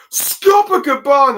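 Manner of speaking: sudden high, loud cry of shock; a villain stunned to see his foe arrive; shocked shout. Retold or imitated. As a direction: yell the name out in disbelief. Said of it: "in a shock expression".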